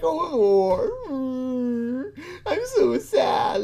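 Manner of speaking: high, drawn-out mock weeping; fake crying. Mocking the interviewee's fake tears, hammed-up crying. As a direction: wail it in a badly faked sob. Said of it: "Look at how fake he is".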